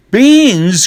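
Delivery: high, loud, shocked cry of Beans?; dismayed cry. A narrator's reading. Mother crying out at the worthless beans. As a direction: shriek it in disbelief. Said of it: "cries his mother".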